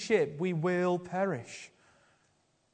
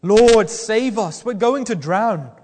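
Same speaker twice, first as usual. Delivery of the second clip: loud, slow, frightened plea; a hint of disciples shouting in a storm; desperate cry for help; 3 semitones higher, 13 dB louder, slower than usual. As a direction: cry out like men about to drown.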